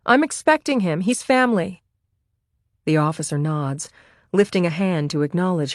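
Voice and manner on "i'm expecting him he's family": calling out. A narrator's reading. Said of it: "she calls out to the officer".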